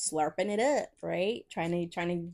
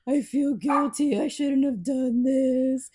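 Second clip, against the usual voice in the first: imitating a crying guy, slow and higher, louder; hammed-up tearful whine; mock crying; 5 semitones higher, 7 dB louder, slower than usual. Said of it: "And as he's crying".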